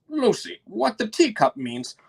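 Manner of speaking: high, croaky frog voice. Acted. Frog character speaks.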